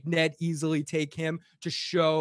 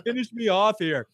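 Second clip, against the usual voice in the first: mock pleading; 6 semitones higher than usual. Joking mock plea.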